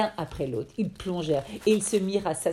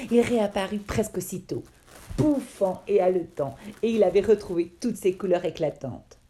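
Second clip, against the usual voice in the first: ordinary delivery, nothing marked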